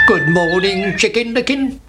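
lightly voiced storybook rooster; greeting that climbs in pitch; Cocky Locky. A narrator's reading. Rooster greets the chick, lightly put-on rising voice. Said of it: "said Cocky Locky".

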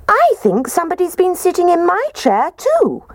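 very high, plaintive cub voice; squeaky baby bear. A narrator's reading. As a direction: squeak it like an upset little bear. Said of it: "And Baby Bear squeaked".

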